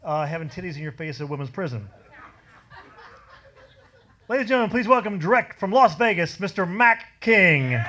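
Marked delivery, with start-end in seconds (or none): 4.3-7.8 announcer intro. Acted. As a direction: sell the headliner big. Host introduces act.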